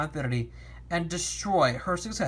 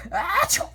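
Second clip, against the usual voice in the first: sneeze; 13 semitones higher, 4 dB louder than usual. Acted. Sneeze in story.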